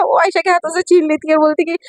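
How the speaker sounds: plain reading voice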